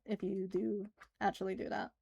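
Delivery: ordinary talk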